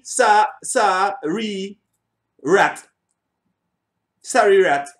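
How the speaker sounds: sounds out "sa-, sorry" very slowly, then "rat"; slow careful try. Shown as a demonstration. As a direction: stretch out "sa-, sorry, rat" syllable by syllable. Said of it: "It no matter how me say it".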